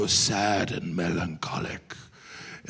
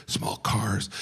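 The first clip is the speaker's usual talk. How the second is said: usual speaking voice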